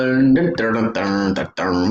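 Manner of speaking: hummed dun-dun drumroll, dropping low; mock suspense sting. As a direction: fake dramatic music cue before an announcement. Dramatic sting before a name.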